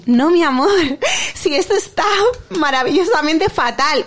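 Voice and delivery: sing-song pretend approval; mock-sweet parenting. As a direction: parody a doting mother, amused. Parodies sweet-talking the child after mischief.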